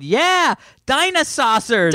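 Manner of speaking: high, drawn-out cheer; mock yell, stretched and high; retold yell. Host retelling a yell at the podcast, high mock yell. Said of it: "and I yelled".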